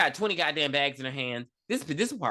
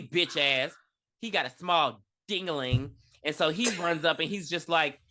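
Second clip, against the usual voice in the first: ordinary delivery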